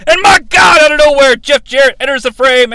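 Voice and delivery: loud, high play-by-play outburst; announcer shock. Acted. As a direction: scream it like the commentator.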